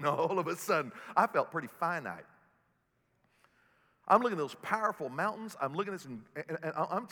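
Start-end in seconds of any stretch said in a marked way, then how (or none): none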